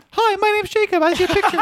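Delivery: high-pitched put-on voice; Jacob. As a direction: put on a high voice as someone introducing himself. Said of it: "your mom sent me a picture of Jacob".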